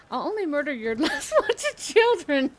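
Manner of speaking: breaking into laughter mid-line, high and loud; laughing. Real, not acted. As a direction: crack up while reading it. Reading event quote, cracks up.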